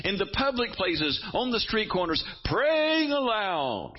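high, impassioned denunciation; preaching at full force. Retold or imitated. Preacher voicing Jesus against public pray-ers, high impassioned rebuke. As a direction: rail against showy prayers. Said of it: "he says".

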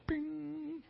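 very high, soft game blip; spaceship bing. Retold or imitated. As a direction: chirp it like an old arcade sound.

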